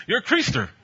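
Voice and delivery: playful laughing jab; laughing tease. Retold or imitated. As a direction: tease him with a chuckle. Repeats jab while laughing.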